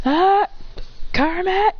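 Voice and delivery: high, drawn-out playful call; high-pitched mock call for attention. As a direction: call out high, stretching the names. Knocking on barrier, calling friends.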